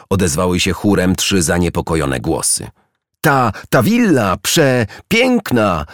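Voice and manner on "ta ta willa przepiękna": high, very slow, halting wonder; awed stammering. A narrator's reading. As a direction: stammer it out, overwhelmed, drawing out the last word. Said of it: "wyjąkała Róża".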